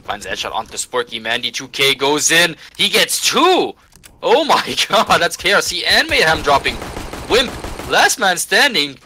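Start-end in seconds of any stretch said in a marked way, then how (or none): 1.2-9.0 loud, high hype call; excited play-by-play. Real, not acted. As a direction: shout the multikill like a caster. Caster hyping a clutch play.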